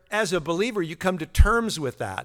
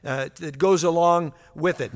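ordinary talk

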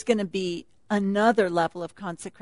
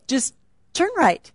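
GPS voice; 5 semitones higher than usual. Retold or imitated. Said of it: "We have the little British accent".

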